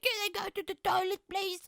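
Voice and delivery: put-on very high, pleading kid voice; a small child begging the teacher; schoolkid asking. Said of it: "I feel like I'm in the fourth grade".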